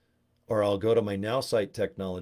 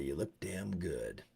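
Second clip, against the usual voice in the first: much softer and slower, a put-on voice; forced polite compliment; 5 semitones lower, 13 dB quieter, slower than usual. Telling a Botox friend she looks good, soft and forced. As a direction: say it through a fake smile, quietly. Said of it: "We just say".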